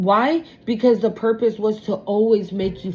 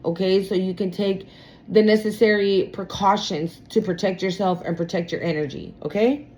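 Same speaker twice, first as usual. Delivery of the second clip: ordinary delivery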